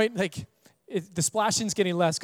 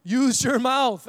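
higher and louder, the last words slow; urgent call; 6 semitones higher, 5 dB louder, much slower than usual. Retold or imitated. Uncle urgently tells his panicking nephew to breathe, a loud slow call. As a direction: urgently coach the panicking kid. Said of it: "I'm like".

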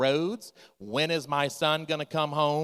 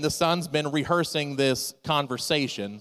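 ordinary talk, nothing marked

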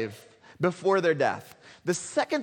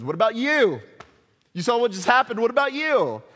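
loud, higher mocking taunt; taunting king; 6 semitones higher, 6 dB louder than usual. The wicked king taunting each son, loud and mocking. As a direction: sneer it at the next son in line.

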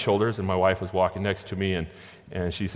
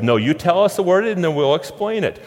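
higher, louder firm insistence; wife pressing the child, higher and louder; insisting wife; 7 semitones higher, 5 dB louder than usual. Retold or imitated. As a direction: push back firmly as the mother. Wife insists daughter says word.

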